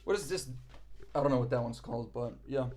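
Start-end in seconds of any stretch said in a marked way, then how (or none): 1.1-2.7 winded after dancing; out of breath. Real, not acted. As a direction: talk while catching breath. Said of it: "Whew, I'm out of breath".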